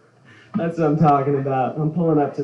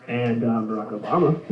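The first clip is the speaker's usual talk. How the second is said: quick lightly done distinguished Obama voice, lower, with gravitas; Obama impression; 4 semitones lower, faster than usual. Shown as a demonstration. Comic announces his presidents set, then a lightly voiced Obama. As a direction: sound like the smartest person you've heard. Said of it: "the key to a good Obama".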